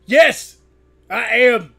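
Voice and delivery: put-on loud, high teenage defiance; defiant shout. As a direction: yell back like a rebellious son. Defiant son shouting back.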